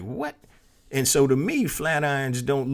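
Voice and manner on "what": high, soft mock-disbelieving "what"; incredulous. Husband to wife on flat iron features, incredulous. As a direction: question it in quiet, high-pitched disbelief. Incredulous reaction to wife's price explanation.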